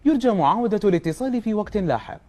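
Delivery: formal recorded phone announcement; robotic error message. Shown as a demonstration. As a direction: read it like an automated operator line. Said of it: "يلا، خلنا نسمعها".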